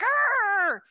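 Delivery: loud, high frustrated arr; comic angry growl at the speeding truck; road rage growl. Retold or imitated. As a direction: let out a mock road-rage roar. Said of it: "you're just like".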